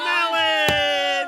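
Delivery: long loud drawn-out win; triumphant shout. Real, not acted. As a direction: stretch it out in triumph. Finally gets the right answer.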